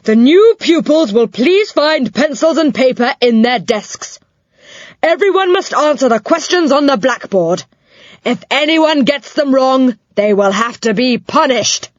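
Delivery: put-on high, loud, strict old dame; stern schoolmistress. A narrator's reading. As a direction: lay down the rules coldly. Dame addresses class.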